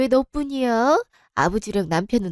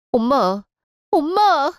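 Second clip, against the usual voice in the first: calling out; 5 semitones higher, slower than usual. Acted. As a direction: call out to the dying mother in panic. Mother dying in hospital.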